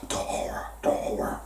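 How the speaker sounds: hushed, voiceless horror whisper; Colonel Kurtz. Retold or imitated. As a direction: breathe it out like a haunted man in the jungle. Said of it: "I feel like Colonel Kurtz in Apocalypse Now".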